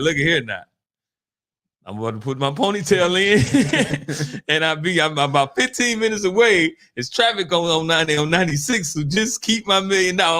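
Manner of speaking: playfully acting the eager taker; eager mock reply. Woman rushing for the million, hammed-up eager reply. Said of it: "it's gonna be like".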